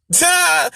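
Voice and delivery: short, loud high cry; sudden high outburst; high yelp. Real, not acted. Caps the acted telling-off.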